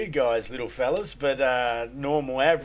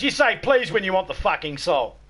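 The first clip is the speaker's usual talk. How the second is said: higher, louder scolding; angry dad; 6 semitones higher, 5 dB louder than usual. Acted. Dad scolding Johnny after hitting him, angry. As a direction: bark it like a furious dad.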